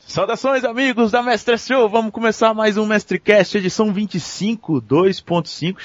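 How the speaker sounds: show host intro. Acted. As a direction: greet the audience like a radio host. Redoing the podcast opening.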